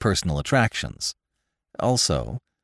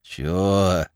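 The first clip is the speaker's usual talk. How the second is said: doggedly. A narrator's reading. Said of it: "said the Bowery boy doggedly".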